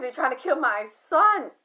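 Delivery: retold crying. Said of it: "Why are you crying?".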